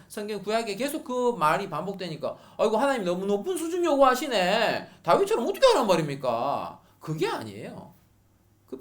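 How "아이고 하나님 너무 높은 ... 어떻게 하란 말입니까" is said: higher, louder exasperated protest; complaining to God. Retold or imitated. Believers grumbling at God's demands, loud exasperated whine. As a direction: whine up at God that it's too much.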